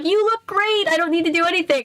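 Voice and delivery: high, breezy and dismissive; cheerful crew voice. Retold or imitated. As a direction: brush it off with a bright high voice. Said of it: "they're just like".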